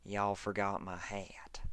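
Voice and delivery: flat, dead whisper. Acted. Farmer to Dash, lifeless dead whisper. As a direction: breathe it out lifeless and slow. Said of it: "Applejack's tone was just as dead".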